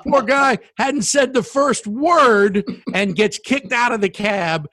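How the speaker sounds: can barely get it out for laughing. Real, not acted. Laughing retelling getting kicked out.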